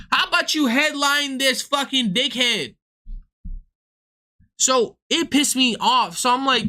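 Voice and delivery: ordinary talk, nothing marked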